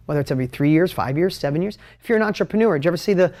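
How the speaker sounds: usual speaking voice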